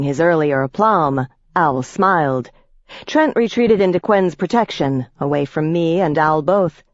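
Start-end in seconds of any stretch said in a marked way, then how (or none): none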